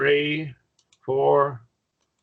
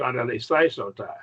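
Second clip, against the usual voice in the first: faster. Shown as a demonstration. Teacher showing a student the repeated word sped up, faster and joined. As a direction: rattle off "Scandalise, they, son, ta" as one word. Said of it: "and then get faster and faster".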